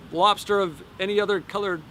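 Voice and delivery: normal talking voice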